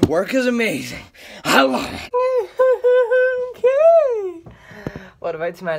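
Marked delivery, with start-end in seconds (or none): 0.1-2.1 mock gushing. Sarcastic gushing about work. 2.1-4.4 squeaky high put-on laugh; cartoonish high giggle; high cackle.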